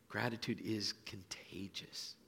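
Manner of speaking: slow, breathy whisper of the refrain. Shown as a demonstration. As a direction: lean in and whisper it slowly.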